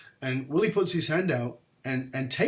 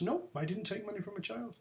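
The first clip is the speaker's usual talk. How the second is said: calm quiet denial; 7 semitones higher, 10 dB quieter than usual. Retold or imitated. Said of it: "He goes".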